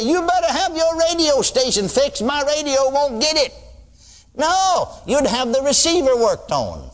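high, mock-indignant caller; mock complaining caller. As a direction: play a fussy man phoning the station. Mock caller complaining about radio.

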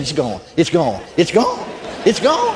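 rising wonder with each repeat, imitating the man; dawning amazement. Healed man to the preacher as pain leaves, dawning amazement.

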